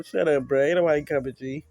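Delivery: loud taunting jeer. Real, not acted. Taunting the screaming victim.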